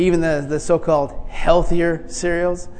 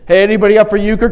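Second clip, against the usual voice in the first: quick, slightly higher casual invite; a hint of a cheery coworker; lightly voiced coworker; 5 semitones higher, 6 dB louder, faster than usual.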